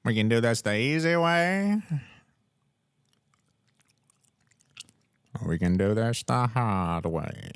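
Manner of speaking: put-on old-time radio announcer, hauling the words out; mock old-timey announcer drawl. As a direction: stretch it out like an old cartoon villain. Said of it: "Was that radio voice".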